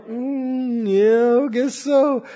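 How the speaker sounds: soft, hesitant mm yeah; half-hearted guess so; mock reluctant agreement. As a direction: shrug it off like an unconvinced churchgoer. Mocking half-hearted believer.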